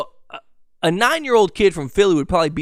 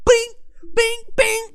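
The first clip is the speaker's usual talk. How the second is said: high-pitched squeaky "bing" for each jab; mock punch noises. Bragging he can fight now, squeaky mock punch noises. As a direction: pop out tiny, high punch sounds.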